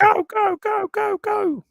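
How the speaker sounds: very high, frantic commentator shriek; Murray Walker. Shown as a demonstration. Co-host showing off an impersonation, frantic racing commentator. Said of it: "I do a very good Murray Walker".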